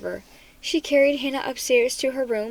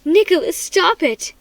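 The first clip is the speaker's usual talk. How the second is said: loud, high scolding; mother cutting her son off sharply; sharp rebuke; 6 semitones higher, 7 dB louder than usual. A narrator's reading. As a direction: snap at him to stop. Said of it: "Mom turned sharply to me".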